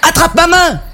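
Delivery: high, urgent call to someone in danger; urgent cry. Acted. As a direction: cry out high to the person you are rescuing. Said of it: "cria Tristan". (cri aigu et pressant)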